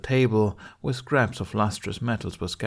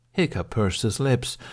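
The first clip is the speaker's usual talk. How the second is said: ordinary delivery, nothing marked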